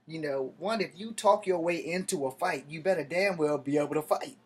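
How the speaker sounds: ordinary delivery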